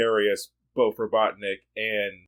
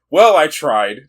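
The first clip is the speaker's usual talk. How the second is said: a light take on a cocky hero, punched out louder; Sonic; 4 semitones higher, 10 dB louder, slower than usual. Acted. Host quoting Sonic's shrug to cohost, lightly voiced and louder. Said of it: "That was pretty good".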